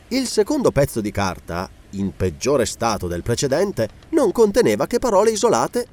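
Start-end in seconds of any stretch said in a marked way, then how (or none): none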